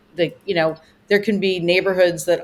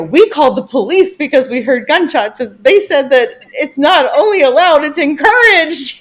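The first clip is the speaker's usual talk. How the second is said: lightly imitated caller, louder and higher; retold guest; 8 semitones higher, 7 dB louder than usual. Guest reporting gunshots to the host, mock indignant retelling. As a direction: retell it loud and raised, stretching the final word. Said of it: "this one guest called me and said".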